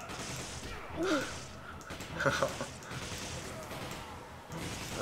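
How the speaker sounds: long high-pitched breathy laughter. Real, not acted. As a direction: laugh helplessly, high and airy. Player laughs during fight.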